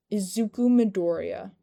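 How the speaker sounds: booming call. Acted. Boss calls to Izuku from inside, booming. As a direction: boom it from across the room. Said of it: "a voice boomed from inside".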